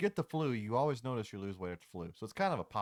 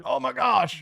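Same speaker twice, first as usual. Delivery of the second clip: high, loud shocked gasp; shocked shout; 13 dB louder, slower than usual. Retold or imitated. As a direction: shriek it in shock. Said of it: "someone that's like".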